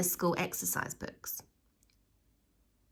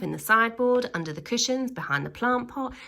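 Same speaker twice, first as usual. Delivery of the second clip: plain reading voice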